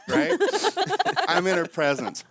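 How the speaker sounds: usual speaking voice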